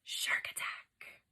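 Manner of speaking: slow hushed whisper; tense whispered title; dramatic whisper. Shown as a demonstration. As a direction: whisper it like a warning.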